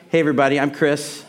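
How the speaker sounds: usual speaking voice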